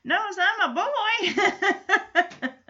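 high, drawn-out comic animal voice; Jack the Bunny. Retold or imitated. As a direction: stretch it out high, breaking into laughter. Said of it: "And Jack the Bunny's like".

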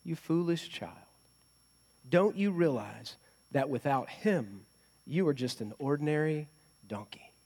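soft, tender mother's voice; gently. A narrator's reading. Mother donkey gently correcting her child, soft and tender. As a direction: ease the words out softly like a loving mother. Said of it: "And his mother said, gently".

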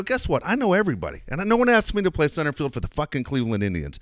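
high, heated rant at the waiter; angry telling-off. Retold or imitated. Father defending his son to the waiter, heated high rant. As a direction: tear into him.

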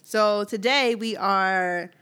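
slow, deliberate host read; slow podcast intro of today's rating; show intro. Acted. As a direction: present the segment slowly to listeners.

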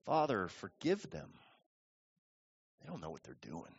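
very soft and low, fading away; faint, reverent plea; hushed dying prayer. Acted. Jesus prays from the cross for his killers, hushed.

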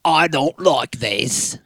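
a rude British judge, barely disguised; Simon Cowell impression. Cohost does a mocking Simon Cowell, lightly British accented. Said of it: "here's my Simon Cowell impression".

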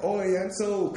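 quick high Cockney "Oi, Enzo"; Cockney greeting. Retold or imitated. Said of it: "a weird Cockney guy".

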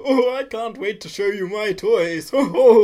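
loud, high, hammed-up old-actor chortle; mock film quote. Host offering his own film line, in a loud mock impression. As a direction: whoop it up theatrically. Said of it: "I would have gone for".

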